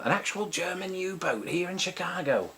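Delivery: put-on jokey Northern English accent for fun; playful northern England accent; mock Northern English accent. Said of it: "we should all start talking like Northerners from England".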